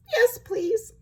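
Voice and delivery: small, high, tearful acceptance; sniffly. A narrator's reading. Sad wolf accepts tea, sniffly. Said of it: "he sniffed".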